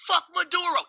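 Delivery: high mock cheer of the people. Venezuelan crowd cheering their leader's fall. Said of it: "the people are like".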